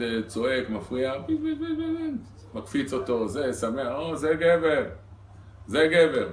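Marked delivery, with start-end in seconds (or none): 1.2-2.1 put-on high squeaky play noises for a baby. Father playing with baby. 3.9-6.3 admiring exclamation. Retold or imitated. Wife admiring husband, louder.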